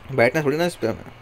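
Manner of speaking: normal talking voice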